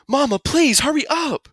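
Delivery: put-on high, urgent child's plea; desperate pleading. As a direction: beg mom in a high panicky cry. Child begs Mama to hurry.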